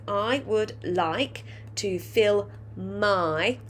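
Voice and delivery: slow, stretched sentence for dictation; slow dictation to young pupils. Shown as a demonstration. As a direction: say each word slowly for the class to write. Teacher rereading while writing.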